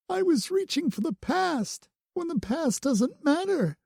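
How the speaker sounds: put-on high, upset girlish rush; berating herself through tears; tearful rant. A narrator's reading. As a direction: Bloom upset while tending him. Bloom crying, blaming herself.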